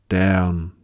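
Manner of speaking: plain reading voice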